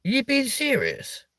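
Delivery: high, disbelieving question; incredulous. Real, not acted. Streamer to chat after the age guess, high incredulous. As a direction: ask it as if she can't believe it. Chat calls her forty-one.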